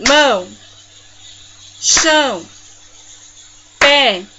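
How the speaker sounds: loud single beats, long pauses between; isolated, loud monosyllables; one clap per word. Shown as a demonstration. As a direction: punch each word out on a clap. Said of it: "batendo palminhas uma vez só".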